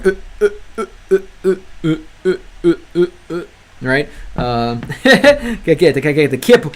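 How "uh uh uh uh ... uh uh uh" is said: string of halting uh sounds, a little softer; demo stutter. Shown as a demonstration. Streamer shows chat how his Russian hesitation sounds, halting uh uh uh. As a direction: show the stuttering hesitation, uh after uh. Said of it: "almost like I'm stuttering".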